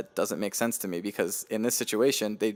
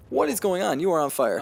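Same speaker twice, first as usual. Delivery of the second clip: much higher, louder mock amazement; playful teasing; 9 semitones higher, 6 dB louder than usual. Real, not acted. Teasing a friend for talking so well, high playful amazement.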